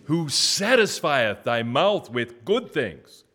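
high, loud proclaiming of scripture; preaching. Acted. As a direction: call out each verse for the church to echo. Leads responsive scripture reading.

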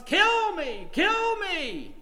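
high, loud, drawn-out yell; imitated drunken yelling. Preacher imitating a drunk man in a ditch, yelling. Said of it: "He was yelling at the top of his lungs".

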